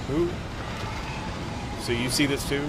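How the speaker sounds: high frantic shouting; panicked yelling. Real, not acted. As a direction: yell in a panic. Panic as rescuer appears.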